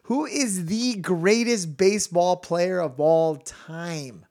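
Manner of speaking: drawn-out, emphatic quiz question; slow deliberate question. Acted. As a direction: stretch the question out word by word. Dog owner poses the decisive question.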